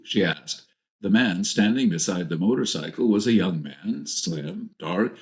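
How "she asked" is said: ordinary delivery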